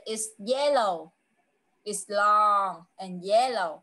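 put-on words stretched out for a young learner; teacher's unhurried, low-pitched clue; slow riddle clues. Shown as a demonstration. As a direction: give the hint slowly, dropping a little in pitch. Teacher gives fruit clues to child.

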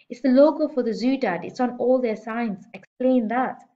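put-on a kid sure she's right; lightly voiced eager girl; insistent girl. A narrator's reading.

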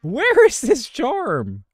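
high, drawn-out loud wail; frustrated yell. Real, not acted. Gamer hunting the lost charm, frustrated high yell. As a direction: cry out in exasperation.

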